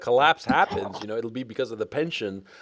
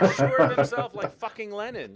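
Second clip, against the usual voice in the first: laughing; 7 semitones higher than usual. Real, not acted. Mocking the co-host.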